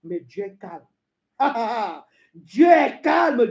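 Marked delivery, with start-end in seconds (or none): 2.3-3.5 high, loud, drawn-out declaration; emphatic proclamation. Acted. Preacher declaring God's calm to believers, emphatic. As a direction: proclaim it slowly and loudly. Emphatic take, later repeated hushed.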